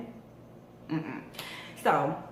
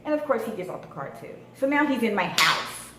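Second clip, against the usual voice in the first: normal talking voice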